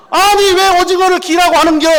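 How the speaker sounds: high, loud indignant complaint; shrill market-auntie outrage; exasperated Gyeongsang auntie. Acted. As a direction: throw up your hands at the market.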